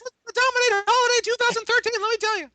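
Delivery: very high squeaky executive parody; skyrocketing voice. Acted. Parodying an executive touting the holiday season, squeaky voice. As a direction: boast in a cartoonish squeak. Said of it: "Your voice just suddenly skyrockets".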